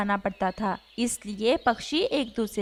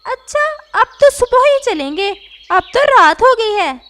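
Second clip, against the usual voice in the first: higher, much louder parrot objecting; loud protesting parrot; 9 semitones higher, 10 dB louder than usual. Acted. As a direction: push back, it's too late. Parrot protests late hour.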